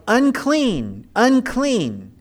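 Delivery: high ringing call of unclean; a preacher voicing the leper's cry. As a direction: call it out like a leper warning the crowd. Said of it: "lepers were required to cry out".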